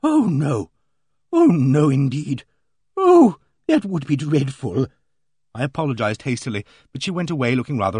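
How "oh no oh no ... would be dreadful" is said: emphatic, louder "oh"s, horrified lady; flustered Emily Barton. A narrator's reading. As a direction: deny it hastily in mild horror. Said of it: "She flushed crimson".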